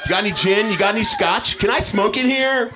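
coked-up guest demanding booze and smokes; jittery cocaine. Acted. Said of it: "And cocaine was like".

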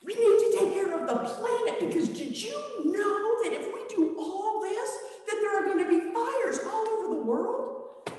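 very high, rising, near-squeaky alarm; frantic warning. Acted. Disciples lecturing Jesus, panicked.

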